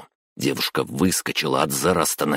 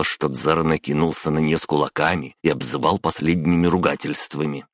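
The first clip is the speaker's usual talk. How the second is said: ordinary delivery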